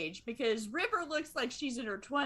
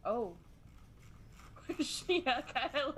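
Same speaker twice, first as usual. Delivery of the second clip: usual speaking voice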